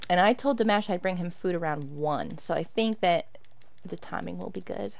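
ordinary talk, nothing marked

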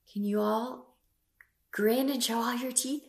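put-on reading to a toddler. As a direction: read it to little kids. Storytime book read to kids.

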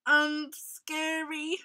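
slow, mildly fearful tiger; gasping tiger. A narrator's reading. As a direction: draw it out with a touch of dread. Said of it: "gasped Tiger".

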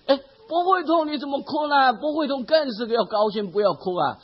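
a mother gently chiding, puzzled. Retold or imitated. Mother asks her weeping son why, mildly puzzled. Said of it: "他的母亲觉得特别奇怪".

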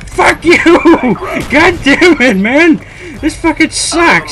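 very high, loud, drawn-out cursing; furious screaming. Real, not acted. Gamer losing out on the money, furious screaming. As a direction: scream each curse out in rage. Raging at the game.

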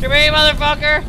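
high, very loud furious shout; all-out bellow. Real, not acted. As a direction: scream it after them. Yelling at fleeing character.

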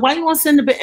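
mock scolding of a nosy fan; sassy retort. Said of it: "I talk like this to people".

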